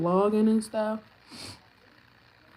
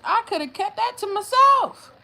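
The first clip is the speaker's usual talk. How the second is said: much higher, loud indignation; much higher indignant complaint; indignant exclaim; 12 semitones higher, 7 dB louder than usual. Real, not acted. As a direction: exclaim the wasted money. Indignant about money spent.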